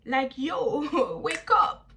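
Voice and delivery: high, loud, drawn-out sassy English; incredulous girl-talk retort; sassy clapback. Retold or imitated. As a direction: snap back at her like a diva. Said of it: "I'm like".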